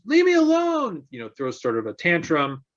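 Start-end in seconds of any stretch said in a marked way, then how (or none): 0.0-1.0 put-on much higher, louder child's protest; arguing child. Child throwing a tantrum at the parent, high and loud. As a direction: squeal it like a kid mid-tantrum. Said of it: "Child argues".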